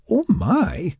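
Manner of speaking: high startled cry; surprised exclamation. A narrator's reading. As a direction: blurt it out in surprise. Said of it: "she exclaimed".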